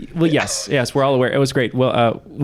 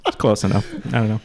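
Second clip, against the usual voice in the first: normal talking voice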